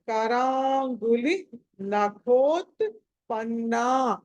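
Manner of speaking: very slow, drawn-out కరాంగులి నఖోత్పన్నా; long final "naa". Shown as a demonstration. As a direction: hold the last vowel of నఖోత్పన్నా. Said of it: "నాకి దీర్ఘం పెట్టుకోవాలి".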